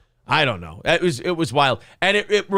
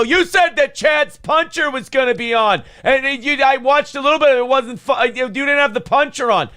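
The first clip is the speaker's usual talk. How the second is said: raised, indignant retold complaint; mock scolding; 7 semitones higher than usual. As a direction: berate him in a higher voice, imitating Kevin. Kevin berating the potato, retold.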